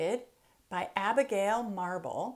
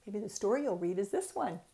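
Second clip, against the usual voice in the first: ordinary delivery, nothing marked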